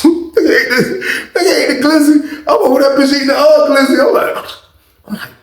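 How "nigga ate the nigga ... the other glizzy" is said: high, laughing through the words; real giggles breaking through the telling. Real, not acted. Telling viewers the roommate ate the hot dog, laughing. Teller cracks up retelling.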